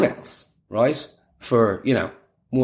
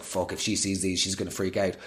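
hushed inner panic; 5 semitones lower, 9 dB quieter than usual. Retold or imitated.

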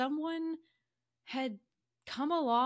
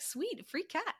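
gleeful; 8 semitones higher than usual. Retold or imitated. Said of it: "He's like".

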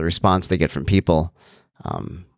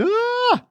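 sudden high-pitched mock wail; short, high yelp, imitating an upset child; imitated upset outburst. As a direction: imitate a child's abrupt squeal of protest. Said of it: "His reactions are sudden".

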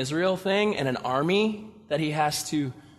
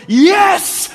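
much higher, loud cheer; triumphant yes; 12 semitones higher, 10 dB louder than usual. Retold or imitated. Gideon cheering his huge army, triumphant. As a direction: punch the air. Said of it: "you're sitting there going".

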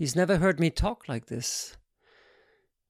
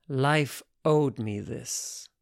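ordinary delivery

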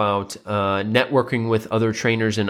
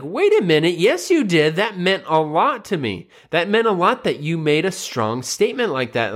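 insistent dad; 6 semitones higher than usual. Retold or imitated. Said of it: "And then the dad's like".

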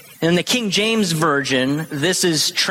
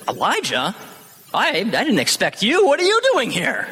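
high, mock-startled question, caught off guard; hammed-up high surprised question; startled surprise; 6 semitones higher than usual. Shown as a demonstration. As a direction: ask as if truly shocked to find him there. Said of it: "this isn't".

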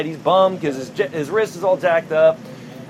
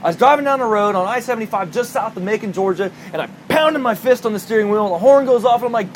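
usual speaking voice

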